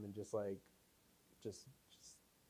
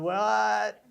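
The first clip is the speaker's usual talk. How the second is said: surprised what; 9 semitones higher, 12 dB louder than usual. Retold or imitated. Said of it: "And I was like".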